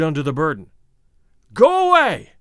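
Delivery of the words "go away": high, loud shout; desperate raised yell; anguished cry. A narrator's reading. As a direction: cry out to drive the voices off. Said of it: "he cried".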